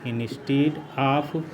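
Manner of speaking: "instead of" said very slowly, word by word; teacher dictating "instead of" at a crawl; slow dictation. Shown as a demonstration. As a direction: spell out "instead of" slowly for students to write. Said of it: "तो यहाँ हो जाएगा instead of".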